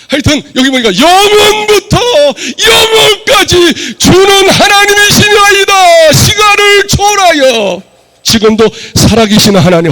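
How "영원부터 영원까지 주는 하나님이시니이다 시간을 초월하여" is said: slow, high, shouted sermon climax; preaching at full force. Acted. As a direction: proclaim it slowly, voice raised high.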